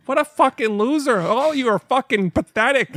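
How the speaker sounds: high mocking jeer; imitating trash talk. Retelling Shorzy taunting high school kids, high jeering voice. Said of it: "He's like".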